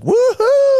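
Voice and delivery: woohoo whooped very high and loud; wild drunken whoop. Retold or imitated. Hanging out of a tuk-tuk, drunk, whooping with joy. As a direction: let out a joyful shout at the night.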